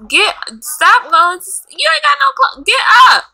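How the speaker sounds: high-pitched outburst building to a shout; exasperated yelling. Real, not acted. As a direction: yell in exasperation, voice shooting up. Yelling at game character to act.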